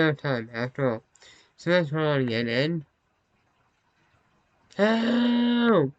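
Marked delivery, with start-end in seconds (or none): 4.8-5.9 high, drawn-out yell; sustained high wail for rescue; cry for help. Acted. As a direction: call out for help in a long, high-pitched cry. Acting out a game character's plea.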